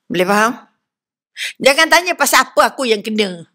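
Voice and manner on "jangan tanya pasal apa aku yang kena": normal talking voice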